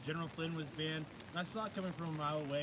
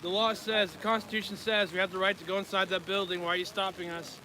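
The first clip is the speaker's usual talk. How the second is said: angry raised voice at officers; shouting; 8 dB louder than usual. Real, not acted. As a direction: yell it out in protest. Protester confronting officers at door.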